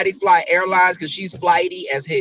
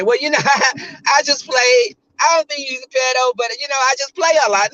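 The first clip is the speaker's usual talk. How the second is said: mock excuse; 7 semitones higher than usual. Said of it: "that's like saying".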